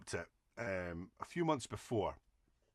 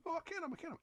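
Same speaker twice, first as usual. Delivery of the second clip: higher, softer mock voice for a pal; mock Andy voice; 7 dB quieter, much faster than usual. Teller mimicking his pal boasting about knowing the manager, higher and softer. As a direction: squeak it like a smug friend. Friend Andy insisting again.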